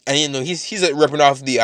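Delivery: ordinary delivery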